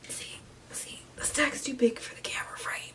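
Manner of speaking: soft whisper. Real, not acted. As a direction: whisper it to the camera. Whispered aside to camera.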